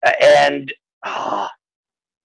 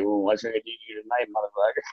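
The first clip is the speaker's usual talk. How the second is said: mock menacing speaker; 8 semitones lower, 10 dB quieter, faster than usual. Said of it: "Now it's probably like".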